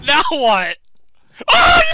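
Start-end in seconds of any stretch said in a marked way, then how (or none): 1.5-1.9 high shocked yelp into laughter; shocked laughing shout. Real, not acted. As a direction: yelp in disbelief then laugh.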